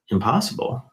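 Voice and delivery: Impossible? said low and rising, relishing the challenge; intrigued echo. Retold or imitated. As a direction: throw Impossible? back as a delighted question. Echoing wife's word with relish.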